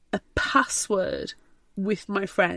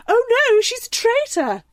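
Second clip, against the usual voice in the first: high, loud cry of alarm; mock alarm; 13 semitones higher, 4 dB louder than usual. Friends spotting the traitor, high mock alarm. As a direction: gasp it out as if betrayed.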